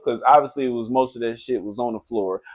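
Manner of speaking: ordinary talk, nothing marked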